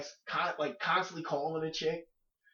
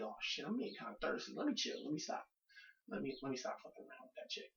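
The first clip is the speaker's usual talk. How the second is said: much softer self-scolding murmur; talking himself down; 9 dB quieter, faster than usual. Retold or imitated. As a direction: quietly tell yourself to stop.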